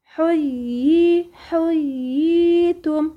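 حييتم very slow, clear doubled y then long ii; very slow tajwid recitation. Shown as a demonstration. Teacher models مد التمكين in حييتم. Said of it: "انتي بتمكني الإيه؟ المد".

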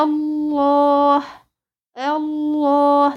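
الله chanted slowly with a full, heavy ل; long-held الله with tafkhim on the ل; heavy lam. Shown as a demonstration. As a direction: recite الله on a steady note, thickening the doubled lam. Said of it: "طبعًا حقها التغليظ".

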